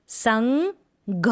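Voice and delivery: संघ stretched very slow, syllable by syllable; slow syllable split. Shown as a demonstration. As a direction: draw out संघ slowly for the class. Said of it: "पढ़ने का अभ्यास करेंगे". (संघ को बहुत धीरे तोड़कर)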